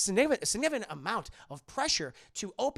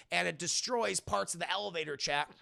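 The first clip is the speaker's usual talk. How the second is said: usual speaking voice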